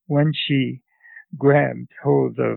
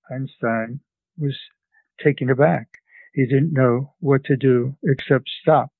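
usual speaking voice

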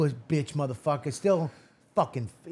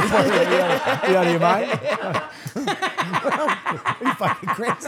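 laughing disbelief; 4 semitones higher, 4 dB louder, much slower than usual. Real, not acted. Mock outrage at a question.

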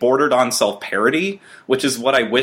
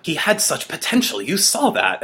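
lightly voiced, higher mock Vogel; a hint of Vogel's fake concern, a bit higher; imitating Vogel; 8 semitones higher than usual. Podcaster mimics Vogel's first scene, lightly voiced and higher. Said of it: "she's like".

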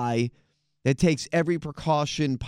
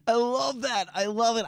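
high delighted laugh into words; 8 semitones higher than usual. Real, not acted. Host reacts to the clip.

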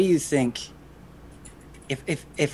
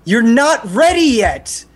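put-on raised, emphatic older-sibling lecture; worked-up acted reprimand; stern scolding; 7 semitones higher, 3 dB louder than usual. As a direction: lay down the law, higher-pitched.

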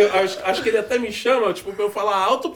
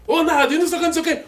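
mock calling out; 8 semitones higher, much faster than usual. Mimics Billy calling Nado repeatedly.

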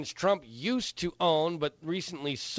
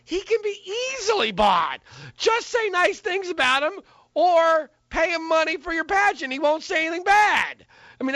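heated rant; 10 semitones higher, 4 dB louder than usual. Real, not acted. Ranting about Trump's flaw.

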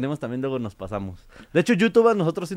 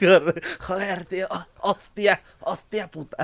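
higher mock Castilian moaning; Spanish porn dub; 4 semitones higher, slower than usual. Mexican host copies dubbed porn, mock Spanish accent. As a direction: imitate a Spaniard in a dubbed adult film. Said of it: "películas porno en español".

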